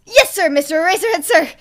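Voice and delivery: high startled yelp of obedience; nervous squeak. Acted. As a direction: snap to attention, squeaky and loud. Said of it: "Hisashi squeaked".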